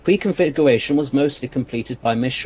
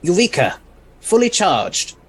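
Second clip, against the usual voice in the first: bright, loud, pleased cry; triumphant eureka; 5 semitones higher, 5 dB louder than usual. Acted. The Doctor greeting his charged tracker, triumphant and loud. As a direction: crow with delight at the finished gadget. Inventor's gadget finishes charging.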